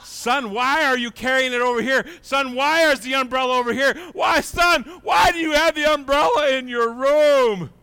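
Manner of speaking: loud, high questioning father voice; fatherly God. Acted. God asking his son about the umbrella, pressing loudly. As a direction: ask the son why, pressing. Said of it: "he says".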